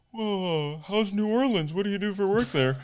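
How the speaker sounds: high-pitched imitated opener; retold small talk. Salesman opening with small talk at the table, put-on high voice. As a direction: pitch it up, lightly mocking yourself.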